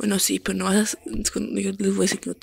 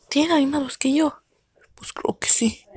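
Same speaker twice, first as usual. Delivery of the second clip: whisper; 5 semitones higher than usual. Acted. Whispered voice held.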